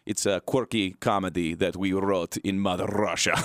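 put-on light comic Russian accent, pitching a show; mock Russian accent. Host pitching his comedy series in mock Russian accent. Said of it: "the series I wrote in Russian accent".

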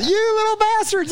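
very high mock screech of outrage; yelling neighbour. Lady running out at bike thieves, shrill yelling. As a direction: imitate a lady yelling after fleeing kids. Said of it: "And she's yelling at us".

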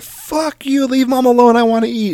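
put-on very high, squeaky baby yelling; baby snapping at his sister to leave mom alone; angry baby voice. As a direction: squeak it out like a furious infant. Baby voiced angrily by dad.

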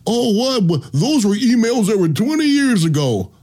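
mocking a coach caught out by old emails; mocking whine. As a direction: mimic his defense with a sneer. Mocking Gruden's excuse.

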